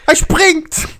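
high shouted street slang; Moroccan Dutch street slang. Retold or imitated. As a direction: yell like a hyped street kid. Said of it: "continues the impression".

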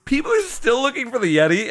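high-pitched mock disbelief; incredulous question. Host recalling his surprise that Yeti hunts continue, incredulous. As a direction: ask it as if you can't believe it. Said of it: "I'm like".

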